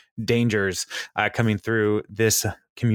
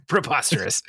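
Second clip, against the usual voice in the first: mock drunk voice; 6 semitones higher than usual. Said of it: "drunk people try to use".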